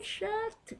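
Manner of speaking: very high, loud yell; high-toned yell. Retold or imitated. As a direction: scream it to silence him. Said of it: "I was so loud ... high-toned yell".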